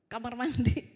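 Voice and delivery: ordinary talk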